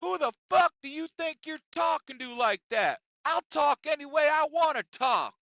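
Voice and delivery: high, loud, indignant shouting; Bill. Retold or imitated. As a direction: yell back like an outraged coworker. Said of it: "Bill shot back up and he says".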